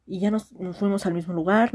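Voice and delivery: ordinary talk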